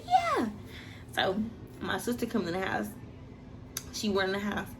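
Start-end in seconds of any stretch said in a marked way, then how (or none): 0.1-0.5 high, strained yeah; squeaked-out yeah; confirming the baby to mom; panicked yeah. Retold or imitated. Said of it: "I'm like".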